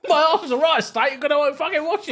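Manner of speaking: high-pitched, hammed-up Cockney; mock Cockney accent. Trading lines as the workmate, mock Cockney. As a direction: play a comic Cockney geezer. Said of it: "It was like a Cockney".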